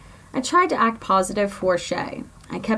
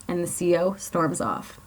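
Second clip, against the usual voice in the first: ordinary delivery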